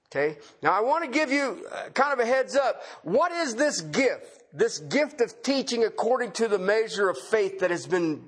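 ordinary talk, nothing marked

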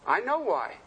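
normal talking voice

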